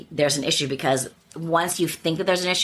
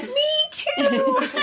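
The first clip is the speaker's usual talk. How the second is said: laughing me too. Retold or imitated. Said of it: "And I was like".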